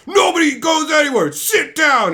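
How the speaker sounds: high, loud mock boss shout; imitated manager yelling. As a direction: bark the order at the room.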